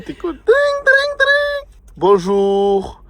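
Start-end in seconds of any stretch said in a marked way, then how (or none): 0.3-1.7 high sung phone ringing; dring ringtone imitation. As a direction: ring like an old telephone. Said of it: "C'est moi qui fais dring".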